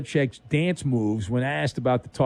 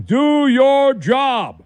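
much higher, louder, slow bellow; yelled catchphrase; 9 semitones higher, 6 dB louder, much slower than usual. Acted. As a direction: bark it like a coach on the sideline. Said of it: "someone yelling".

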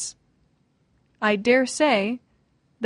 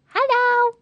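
very high, squeaky little greeting; playful hello; 14 semitones higher, 3 dB louder than usual. Pretend. Host greets the roommate, playfully high. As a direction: chirp it playfully, far above usual pitch.

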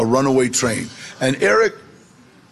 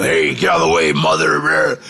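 much lower, gruff convict growl; 11 semitones lower than usual. Retold or imitated. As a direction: bark it like a tough prison guy. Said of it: "Just really growling".

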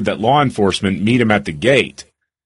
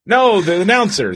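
ordinary talk, nothing marked

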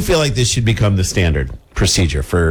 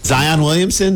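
usual speaking voice

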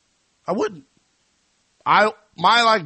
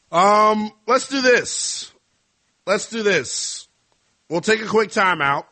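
ring announcer sign-off; 8 semitones higher, 4 dB louder than usual. Pretend. Host announcing a quick timeout, high announcer style. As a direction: send it to break like a ring announcer, stretching the last words.

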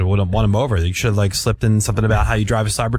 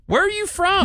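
much higher, asking like a baffled local; puzzled stranger; slower than usual. Retold or imitated. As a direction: ask it as a surprised outsider. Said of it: "people go".

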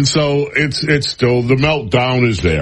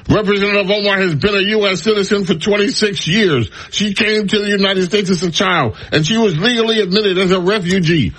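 higher-pitched, insistent quote voice; raised mock-earnest statement voice; mock-earnest reading; 7 semitones higher than usual. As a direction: read it with put-on indignation. Reads opponents' statement in put-on voice.

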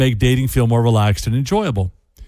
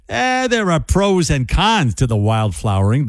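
put-on a touch of New York accent, a bit higher; 4 semitones higher than usual. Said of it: "They don't say it with a New York accent, but I do".

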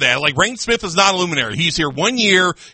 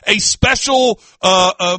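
ordinary talk

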